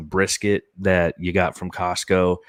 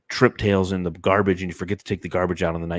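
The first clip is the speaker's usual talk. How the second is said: ordinary delivery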